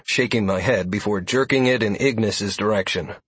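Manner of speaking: ordinary delivery, nothing marked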